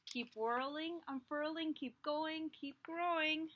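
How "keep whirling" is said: ordinary delivery, nothing marked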